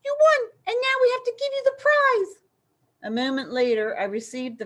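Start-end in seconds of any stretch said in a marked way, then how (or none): 0.0-2.4 very high, loud squeaky mouse voice. A narrator's reading. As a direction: keep the squeak going, excited. Rodento keeps squeaking.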